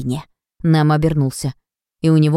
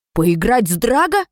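howling; 5 semitones higher than usual. A narrator's reading. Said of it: "взвыл Фред".

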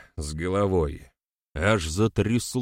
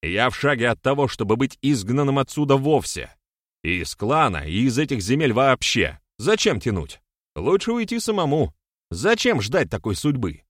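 raised, higher-pitched agitated argument; indignant protest; 5 semitones higher, 4 dB louder than usual. A narrator's reading. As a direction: argue heatedly, pitch jumping up. (горячо, возмущённо)

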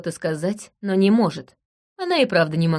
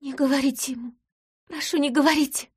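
frightened plea; 8 semitones higher than usual. Acted. Begs her husband not be told.

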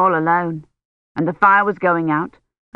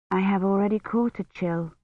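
soft, hushed inner voice; inward thought; 7 dB quieter than usual. A narrator's reading. Kivrin privately answers talk of chill, inward thought. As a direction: think it quietly to oneself. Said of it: "Kivrin thought".

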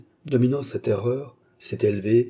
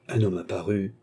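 usual speaking voice

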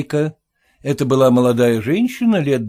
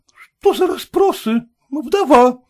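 high, bewildered reply; lady's voice, bewildered; puzzled lady; 13 semitones higher, 3 dB louder, slower than usual. A narrator's reading. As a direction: answer while taken aback. Lady answers.

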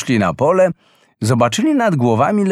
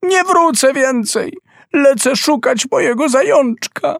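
much higher, defiant cry; shouting; 13 semitones higher than usual. Acted. Boy shouting down to his mother, much higher defiant cry. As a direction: refuse her, shouting down from above. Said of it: "krzyczał Mrille".

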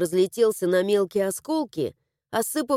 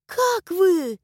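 put-on high, eager girl voice; girlish concern; 8 semitones higher than usual. A narrator's reading. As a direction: ask anxiously in a high voice.

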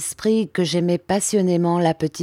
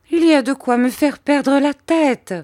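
high, louder exclamation; exasperated cry; 9 semitones higher, 5 dB louder than usual. A narrator's reading. Mother at her wits' end with servant and son, crying out. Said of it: "s'écria ma mère".

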